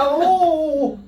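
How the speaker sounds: high, very loud drawn-out "oh"; loud exclamation. Real, not acted. Teacher bursts out at students then says sorry, loud exclamation. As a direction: cry out loudly mid-argument. Outburst while arguing, then apologizes.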